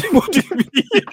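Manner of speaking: high, laughing "what do you mean"; laughing disbelief. Real, not acted. Laughing back at his friend's complaint about his tone. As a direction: burst out laughing mid-question.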